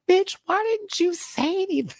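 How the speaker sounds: very high mock indignant squeal; mock outrage. Answering Blackberry in chat, high mock outrage. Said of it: "I was like".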